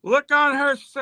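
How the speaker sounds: put-on high, slow villainess accent; Baroness accent. Baroness scorning the October Guard, in a high put-on accent. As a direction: falsetto woman's accent, drawing the words out to a cry. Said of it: "have you got a Baroness accent".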